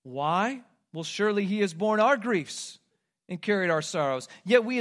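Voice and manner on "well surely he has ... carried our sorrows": ordinary talk, nothing marked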